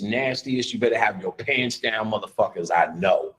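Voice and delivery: ordinary talk, nothing marked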